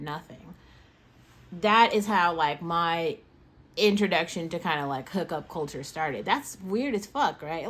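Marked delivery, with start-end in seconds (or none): none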